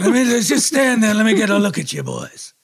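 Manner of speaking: overacted weary father; dying old father. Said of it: "I'm gonna be Harry Dean".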